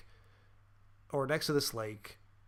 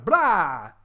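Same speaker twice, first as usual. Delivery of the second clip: mock jump scare; 10 dB louder than usual.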